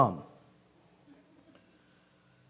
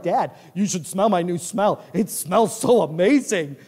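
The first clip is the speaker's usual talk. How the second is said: happy dog; 4 dB louder than usual. Acted. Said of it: "he's as happy as can be".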